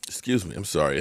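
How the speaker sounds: usual speaking voice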